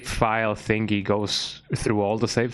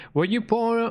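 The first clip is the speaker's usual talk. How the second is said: squeaky high voice; 12 semitones higher than usual. Acted. Reading chemistry book, squeaky.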